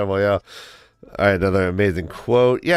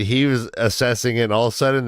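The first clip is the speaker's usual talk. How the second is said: usual speaking voice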